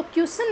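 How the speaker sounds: क्वेश्चन slurred as "kyu-sun"; common mispronunciation. Shown as a demonstration. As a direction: drop the "ch" from क्वेश्चन. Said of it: "नहीं होगा". (क्वेश्चन को "क्युसन" बोलें)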